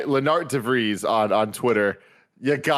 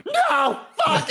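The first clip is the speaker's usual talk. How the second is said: angry outburst; 11 semitones higher than usual. Retold or imitated. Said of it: "Kevin just goes".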